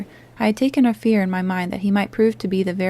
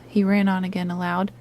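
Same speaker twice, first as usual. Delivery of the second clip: usual speaking voice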